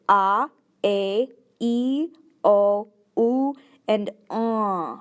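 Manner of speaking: "ah, a, e, o, u, uh" each vowel sounded out clearly and separately; Oneida vowel sounds. Shown as a demonstration. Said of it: "vowels sound like".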